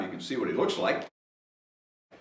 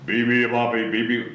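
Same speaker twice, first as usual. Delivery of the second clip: mild mock yell. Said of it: "yelled the hippos".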